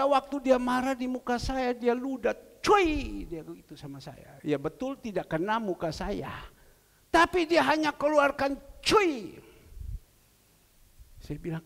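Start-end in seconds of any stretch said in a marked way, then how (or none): 2.6-3.2 spitting sound. Retold or imitated. Brother spits in anger. 8.8-9.5 spitting sound. Retold or imitated. Brother spits in anger.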